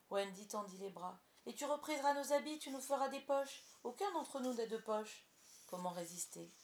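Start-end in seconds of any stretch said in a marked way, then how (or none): 1.4-5.3 high, loud, insistent coaxing; excited pleading in a raised voice; eager persuasion. A narrator's reading. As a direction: pile on the reasons eagerly. Peter presses his tempting plea.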